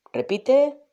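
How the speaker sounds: ordinary talk, nothing marked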